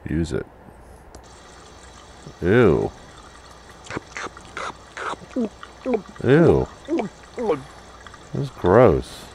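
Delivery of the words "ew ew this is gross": disgusted. Real, not acted. Reacts to a gross game scene.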